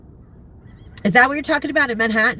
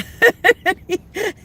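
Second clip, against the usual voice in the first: burst of laughter; 11 semitones higher, 4 dB louder than usual. Real, not acted. Laughing at own story.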